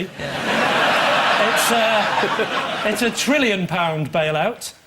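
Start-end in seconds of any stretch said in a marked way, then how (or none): none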